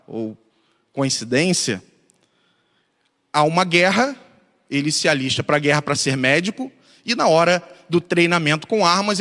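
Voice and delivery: usual speaking voice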